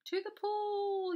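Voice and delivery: high, loud, long-drawn call; rallying storybook yell. A narrator's reading. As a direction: holler it out, stretching the last word. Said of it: "yelled the fireman".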